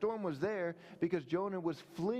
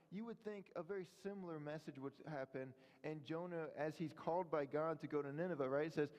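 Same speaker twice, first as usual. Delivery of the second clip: ordinary talk, nothing marked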